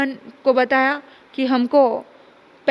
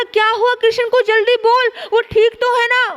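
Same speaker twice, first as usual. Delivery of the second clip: higher, pressing, worried questions; anxious urgency; 7 semitones higher than usual. Acted. Gopi begs Uddhav for news of Krishna, anxious. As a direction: demand news fast, fearing the worst.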